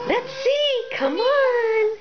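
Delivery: high coaxing pet voice. Real, not acted. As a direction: coax the puppy over sweetly. Coaxing the dog over.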